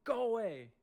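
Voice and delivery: high, drawn-out commanding shout; re-enacted protective holler; retold yell. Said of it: "I yelled at the aggressive duck".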